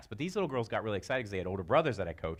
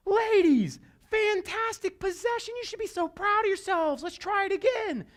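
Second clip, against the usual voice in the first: high, bright praise, slowed at the start; encouraging coach; 4 dB louder than usual. Retold or imitated. Coach praising her girls after the miss, high excited. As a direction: cheer the girls on proudly. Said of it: "And I'm like".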